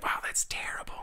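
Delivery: breathy whispered aside. Retold or imitated. A recruit reacting to the frog voice, whispered. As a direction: whisper it to yourself. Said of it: "you're like".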